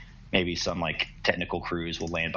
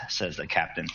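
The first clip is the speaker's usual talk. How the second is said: usual speaking voice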